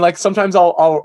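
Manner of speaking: ordinary delivery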